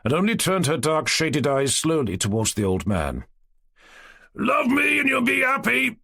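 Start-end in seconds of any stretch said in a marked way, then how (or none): 4.3-6.0 put-on high shout at the girl; old Cossack shouting after a girl, teasing. A narrator's reading. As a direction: call it out much higher. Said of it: "shouted Eroshka".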